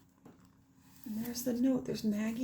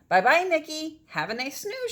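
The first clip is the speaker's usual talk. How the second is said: cheerful call; 7 semitones higher, 7 dB louder than usual. Acted. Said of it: "she called".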